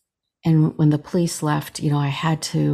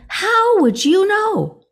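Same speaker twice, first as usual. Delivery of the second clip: much higher, slow, pleading disbelief; stunned disbelief; 5 dB louder, slower than usual. Retold or imitated. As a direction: ask it again high and slow, unable to believe. Repeats question in shock.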